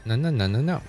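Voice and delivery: ordinary talk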